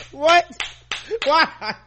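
very high, loud incredulous questions; high-pitched astonished outburst; laughing disbelief. Real, not acted. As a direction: squeal it in disbelief. Reacting to shocking line.

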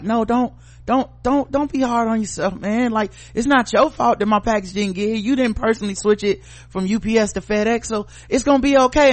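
high, soothing reassurance; mock reassuring. Caller imitating himself consoling a sorry phone agent, high and soothing. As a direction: comfort the apologizing agent. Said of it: "on the phone with this motherfucker like".

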